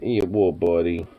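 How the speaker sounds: ordinary talk, nothing marked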